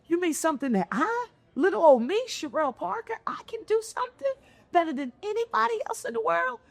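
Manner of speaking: put-on young self astonished at the mentor's promise; amazed disbelief. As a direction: gasp it out like a stunned teenager. Retelling her teen self's disbelief.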